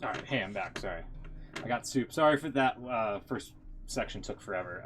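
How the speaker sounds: ordinary delivery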